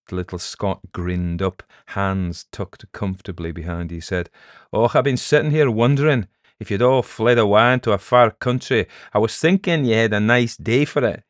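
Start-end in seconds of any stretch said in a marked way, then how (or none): 4.7-11.2 loud, Scottish-voiced needling; mock them with a cheery Scots lilt; teasing Scottish peddler. Little Scot teasing the villagers who fled, mocking.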